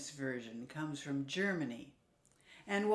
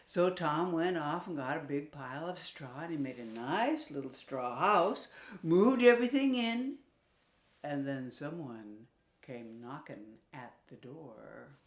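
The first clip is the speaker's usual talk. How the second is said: ordinary delivery, nothing marked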